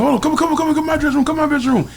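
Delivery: high excited beckoning; Hammer calling. Retold or imitated.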